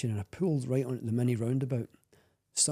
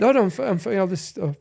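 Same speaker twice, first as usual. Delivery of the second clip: brushing off tears; 7 semitones higher, 7 dB louder than usual. Real, not acted. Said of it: "I was expecting to be crying".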